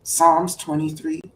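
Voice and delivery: normal talking voice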